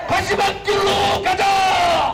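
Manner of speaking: high, slow, booming battle cry; roaring rallying speech. Real, not acted. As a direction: rouse the crowd for the final fight. Closing rally chant.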